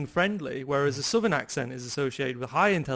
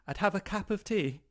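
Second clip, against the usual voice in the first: posh Queen's English accent, higher and softer; 5 semitones higher, 4 dB quieter than usual. Shown as a demonstration. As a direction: say it in received pronunciation. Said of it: "Where the Queen's English would be".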